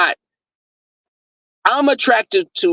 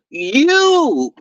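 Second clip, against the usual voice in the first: mocking; 6 semitones higher than usual. Mocking cohost's story.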